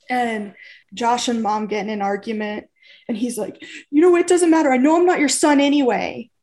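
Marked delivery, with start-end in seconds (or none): 3.9-6.3 raised, louder retold yell at mom; a teen snapping back at his mother, loud and hurt; angry outburst. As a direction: throw it at her in anger. Brother arguing with mom at dinner.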